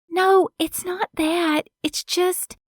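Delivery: put-on high, halting young woman; stuttering young woman. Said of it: "Kiki's voice stuttered".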